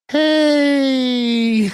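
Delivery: high, drawn-out coy hey; a lightly voiced flirty greeting.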